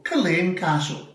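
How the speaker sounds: Culzean Castle said kul-AYN castle; Scottish place name. Shown as a demonstration. Uncle giving the full name Culzean Castle. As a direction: Culzean with no z sound, stressed at the end. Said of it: "That's right".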